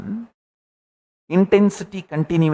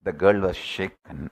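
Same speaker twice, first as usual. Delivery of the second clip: normal talking voice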